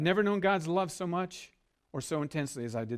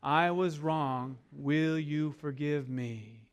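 very slow, spaced-out recital; drawn-out call for the crowd to repeat; slow group recital; much slower than usual. Shown as a demonstration. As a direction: lead the room word by word. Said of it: "Say 'em out loud".

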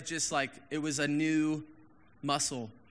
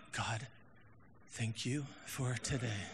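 softer, slow, syrupy fake prayer; mock pious prayer; 5 semitones lower, 9 dB quieter, slower than usual. [name] mocking a polite empty prayer, soft and slow. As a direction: parody a too-polite prayer voice. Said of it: "Don't be like, 'Oh".

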